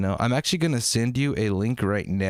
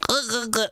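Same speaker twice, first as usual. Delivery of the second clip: much higher uh, uh, uh sounds; weird uh sounds; 12 semitones higher, faster than usual. Shown as a demonstration. Streamer showing the uh sounds he keeps adding, much higher. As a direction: squeak out the uh sounds. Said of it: "that weird fucking vo".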